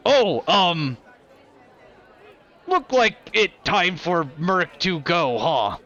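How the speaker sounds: lightly voiced blunt brute in broken speech; a hint of a simple brute; brutish Merc. Merc to the party announcing she leaves, a lightly voiced brute.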